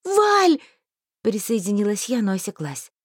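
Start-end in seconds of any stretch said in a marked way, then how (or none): 0.0-0.6 sharp high call of a name. A narrator's reading. Heroine calling to vanishing friend, loud call. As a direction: cry out to stop him.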